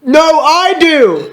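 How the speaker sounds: high, drawn-out mock shout. A narrator's reading.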